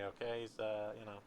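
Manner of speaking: ordinary talk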